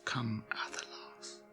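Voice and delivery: breathy whispered spell; hushed whispered chant; whispered incantation. Acted. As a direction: whisper the name into the leaves.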